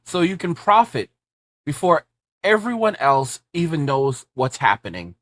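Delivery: urgent, punchy promo pitch; ad read. Acted. Host pitching his guide to viewers, urgent ad read. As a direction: sell the investment guide hard.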